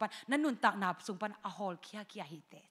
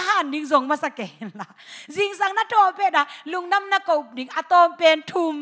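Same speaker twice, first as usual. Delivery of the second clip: loud, raised preaching voice; fiery preaching; 6 semitones higher, 9 dB louder than usual. Acted. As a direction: drive it home to the congregation. Preacher builds to full force.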